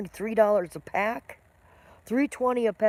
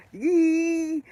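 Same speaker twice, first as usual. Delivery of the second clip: a high, louder squeal of nerves; anxious high squeak; 8 semitones higher, 8 dB louder than usual. Shown as a demonstration. To viewers, showing how anxiety pushes her voice up, high squeal. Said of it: "And you're not so up here".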